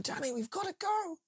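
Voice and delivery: very high mock panic, voice cracking toward tears; desperate soldier. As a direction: beg him to move, near crying. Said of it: "and they're going".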